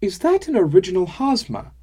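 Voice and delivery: loud, high excited blurt. Acted. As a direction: burst out in sudden amazement. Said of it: "I blurted".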